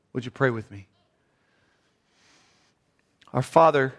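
low, soft, hushed prayer with long pauses. Real, not acted. Pastor leading the congregation in prayer, hushed and low.

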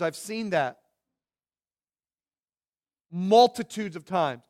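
normal talking voice

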